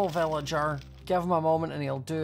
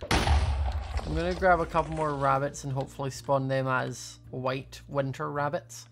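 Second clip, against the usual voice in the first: ordinary delivery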